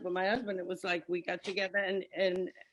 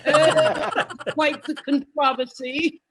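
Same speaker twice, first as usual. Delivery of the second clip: hammed-up stiff posh Englishman, louder; mock the reserved British husband; a prim, fussy English gent; 7 dB louder than usual. Mocking her English husband's reserve.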